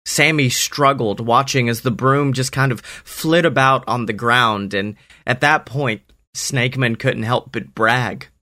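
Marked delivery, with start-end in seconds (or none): none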